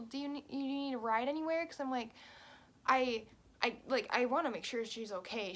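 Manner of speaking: usual speaking voice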